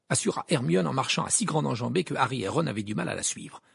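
plain reading voice

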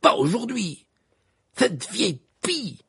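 high, indignant outburst; exasperated exclamation. A narrator's reading. Ron cursing the reporter over the headline, exasperated exclamation. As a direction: exclaim in high-pitched dismay. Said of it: "s'exclama-t-il".

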